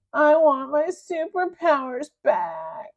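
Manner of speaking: high, slow, crying whine; a defeated villain crying for his powers; sobbing. Acted. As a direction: whimper like a spoiled kid. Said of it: "sobbed Big Melvin".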